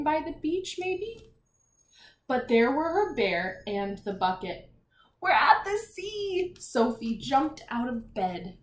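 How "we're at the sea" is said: excited. Acted. Sophie excited at the sea.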